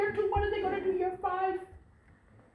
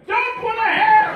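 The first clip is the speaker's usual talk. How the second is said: loud, higher coaching yell; 7 semitones higher, 13 dB louder than usual. Pretend. Coach at bedside to young man, loud yelling. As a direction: bark tips like a sideline coach.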